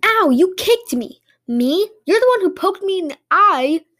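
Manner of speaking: high, loud indignant accusations; hammed-up street quarrel; squabbling. A narrator's reading. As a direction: yelp it, then fire back outraged. Acted quarrel as fights break out.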